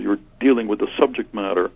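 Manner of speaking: ordinary delivery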